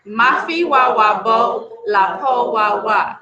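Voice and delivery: slow, measured recitation of a proverb; solemn proverb. Acted. Storyteller reciting the proverb to listeners, slow and weighty. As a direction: intone it like ancestral wisdom.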